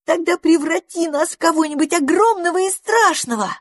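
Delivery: put-on very high eager squirrel kids; pleading with high excitement; excited. A narrator's reading.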